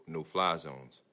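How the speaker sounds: ordinary delivery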